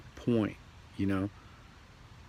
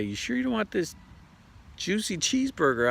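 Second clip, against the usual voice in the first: much higher, coaxing tease; tempting coworker; 11 semitones higher than usual. Retold or imitated. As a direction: dangle the burger temptingly. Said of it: "They're gonna say".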